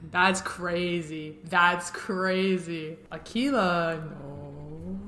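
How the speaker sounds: slow, drawn-out disbelief; stunned disbelief. Real, not acted. Viewer stunned by the jury's switch, slow drawn-out disbelief. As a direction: stretch each word in disbelief.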